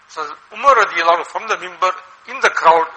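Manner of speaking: normal talking voice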